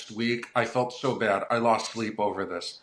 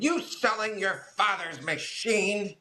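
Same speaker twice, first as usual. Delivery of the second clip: higher, slower mother voice, lightly voiced; a hint of an upset mother confronting her son; retelling the mother's upset challenge; upset old mother; 10 semitones higher than usual. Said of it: "you can hear she's upset".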